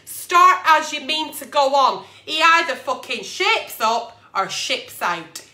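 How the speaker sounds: ordinary talk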